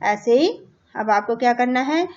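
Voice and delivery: usual speaking voice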